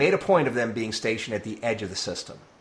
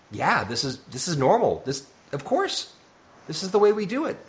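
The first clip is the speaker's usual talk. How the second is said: rising, breezy of-course delivery; mock matter-of-fact; 7 semitones higher than usual. Voicing the Terran Empire's self-interest, mock matter-of-fact. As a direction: act as if it's perfectly ordinary, pitch climbing.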